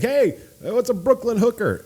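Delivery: high mock call-out; retold yelling. Bartender calling to a coworker for help, mock yell. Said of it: "I was yelling".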